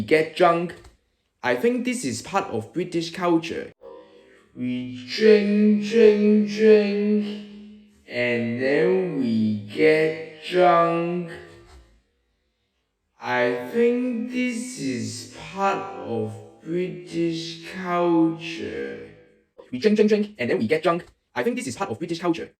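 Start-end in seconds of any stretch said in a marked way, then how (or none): 4.5-19.1 very slow, stretched-out words; slow motion. Shown as a demonstration. Student describing British drinking, in comic slow motion. 19.7-22.5 quick run-through of the line; fast repeat. Shown as a demonstration. Student summing up British drinking, rapid delivery.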